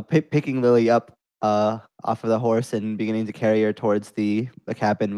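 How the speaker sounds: ordinary talk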